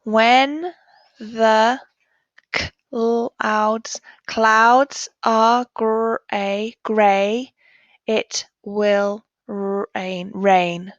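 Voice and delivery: very slow, word by word, leading the class; slow choral reading. Shown as a demonstration. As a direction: read it aloud slowly for children to follow. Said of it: "Let's read it together".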